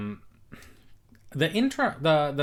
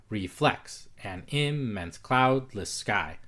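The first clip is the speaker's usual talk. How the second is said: very slow, hard and soft syllables contrasted in "Reflects an immense cloudless sky"; much slower than usual. Shown as a demonstration. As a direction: speak it like music, stressing the beats of "Reflects an immense cloudless sky". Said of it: "pronounce the hard soft".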